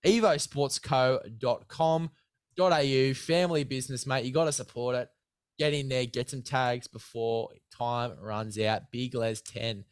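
upbeat sponsor pitch to a mate; hyped ad read. Acted. Host pitching sponsor gear to guest, hyped ad read. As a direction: sell the gear like a mate on air. Sponsor code plug continues.

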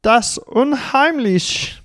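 Das Unheimlich in a hammed-up German accent, high and slow; mock-sinister German accent, drawn out; put-on German accent. Shown as a demonstration. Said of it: "that's the accent I decided to go with".

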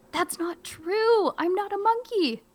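high, slow mock outrage; indignant denial. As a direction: deny it, clearly offended.

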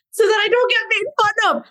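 high-pitched put-on Indian accent; fake Indian accent. Shown as a demonstration. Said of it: "I actually put up a fake Indian accent".

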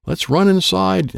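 high, slow panicked yell. Acted. Alan yelling at friends to run inside, panicked. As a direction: cry out to get everyone indoors. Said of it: "Alan yelled".

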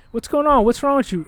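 high, urgent questioning; retold parental fussing; parents demanding answers. Voices worried parents questioning him.

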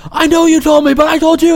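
high mock yell of the other guy; yelling passenger. Passenger yelling back at the booth guy, high mock yell. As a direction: shout it back, high and hammed-up. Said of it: "He's like".

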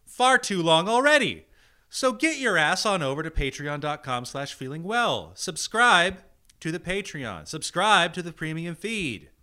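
loud, high hammed-up rant; mock angry rant. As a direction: rant the plug like a tyrant. Mock angry Patreon plug.

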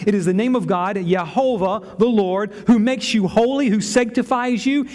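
normal talking voice

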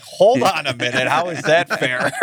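mock indignation. Retelling an argument with his fiance.

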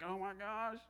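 fearing the sponsor will explode; panicked thought. Retold or imitated. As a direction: blurt it out in alarm. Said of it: "and I'm like".

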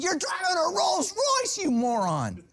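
high-pitched, incredulous mock yell; hammed-up road rage, falling on the insult; exasperated outburst. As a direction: squeal at an idiot driver in comic outrage. Acts out outrage at the driver.

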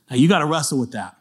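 ordinary talk

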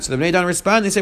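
ordinary talk, nothing marked

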